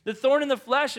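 Paul crying out to God; desperate plea. Retold or imitated. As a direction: beg to be rescued. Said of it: "Paul is asking".

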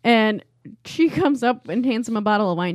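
ordinary talk, nothing marked

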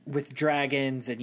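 normal talking voice

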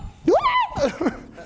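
a sudden high burst of laughter. Real, not acted. Preacher laughs at his own morgue joke, high.

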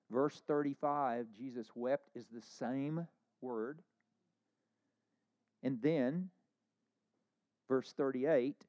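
loud, driving pulpit delivery; full-force preaching. Acted. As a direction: preach it out to the back pews. Preaching emphasis on the Greek word.

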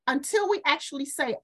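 ordinary talk

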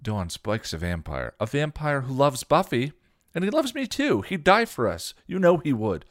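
high, loud insistent pleading. A narrator's reading. Dawn insists to Buffy Spike would never betray her. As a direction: fight for him with every word.

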